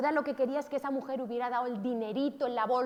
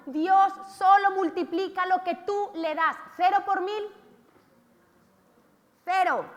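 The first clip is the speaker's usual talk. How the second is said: raised, slowed proclamation; emphatic preaching; 6 semitones higher, 8 dB louder than usual. Real, not acted. Preacher to congregation, loud and emphatic. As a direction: drive the point home to the congregation.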